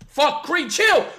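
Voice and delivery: yelling at dog. Real, not acted. Streamer yelling at his dog under the desk, loud alarmed yell.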